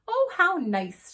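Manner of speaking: high, loud, drawn-out exclamation; delighted. A narrator's reading. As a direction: greet the news with a big, slow swoop. Pleased at expected guest arriving.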